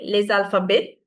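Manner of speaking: French les alphabets, ending without T; silent T in alphabets. Shown as a demonstration. Teacher corrects the class, alphabets with silent T. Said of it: "We don't pronounce the T".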